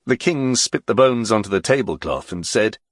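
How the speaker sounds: usual speaking voice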